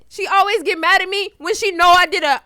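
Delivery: ordinary talk, nothing marked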